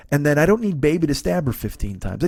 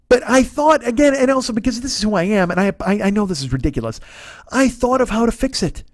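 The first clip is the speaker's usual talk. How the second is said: ordinary delivery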